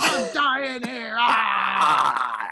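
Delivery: high, loud hammed-up scream; mock screaming. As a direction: shriek as if swarmed by bees. Mock bee attack scream.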